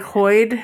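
"Hoyd" for hard, with an "oy" vowel; guessed child pronunciation. Shown as a demonstration. Host guessing how Sherpy says hard, "Hoyd". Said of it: "Could she say hard".

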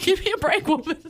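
put-on baby talking back to mom; baby voiced sassy. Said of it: "he's just like".

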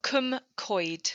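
Cwm Coed said as "koom koyd"; Welsh pronunciation. Shown as a demonstration. Author answers how the town Cwm Coed is said, careful Welsh pronunciation. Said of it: "How do you pronounce the town name?".

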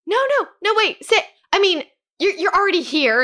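high, fluttery no no no, wait; flustered backpedal. Retold or imitated. Snooty seller backpedalling to keep the realtors, high and flustered. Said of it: "the lady is like".

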